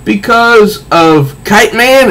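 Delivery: high, slow disbelief; disbelieving Riddler. Acted. Riddler incredulous at Kite Man, high and slow. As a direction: drag out the outrage.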